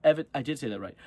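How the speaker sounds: usual speaking voice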